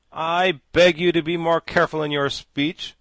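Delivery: sharp loud scolding; sharply. A narrator's reading. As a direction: cut him off with a rebuke. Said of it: "said the Pumpkinhead sharply".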